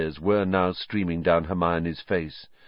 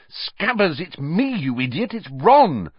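hissed; 8 semitones higher, 4 dB louder than usual. A narrator's reading. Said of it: "Ron hissed".

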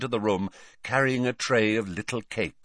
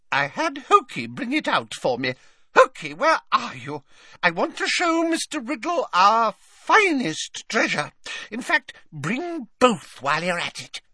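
put-on old lady voice; 3 dB louder than usual. A narrator's reading. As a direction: coo it like a doting old woman. Old witch calling elf.

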